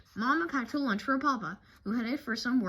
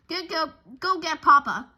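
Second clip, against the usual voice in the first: loud, higher urgent push; urgent order; 6 semitones higher, 9 dB louder than usual. Acted. Sister sending brother to fetch papa, loud and urgent. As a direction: send him off quickly. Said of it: "Sister said to Brother".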